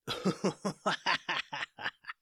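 soft, high mad cackle; maniacal laugh. Acted. The pirate captain laughs maniacally at the heroes. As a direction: cackle like a villain. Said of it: "began laughing maniacally".

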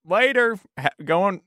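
high excitable shopkeeper voice; chirpy fast-talking merchant; high character voice. Acted. Player voices Chip.

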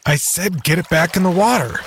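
high, sharp insistent order; angry demand. A narrator's reading. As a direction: snap the order at him. Scientist demands angrily.

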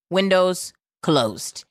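normal talking voice

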